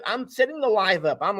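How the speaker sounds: ordinary talk, nothing marked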